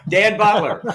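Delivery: Dan Butler blurted high and loud, the name finally remembered; sudden recall. Real, not acted. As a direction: shout out Dan Butler as it hits you. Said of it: "that's the way I write".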